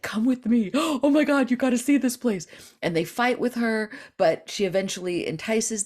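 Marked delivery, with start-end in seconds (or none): 0.0-2.5 high, loud eager coaxing with a gasp; enticing. Retold or imitated. Girl coaxing the dying, high eager coaxing. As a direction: lure them in with excitement.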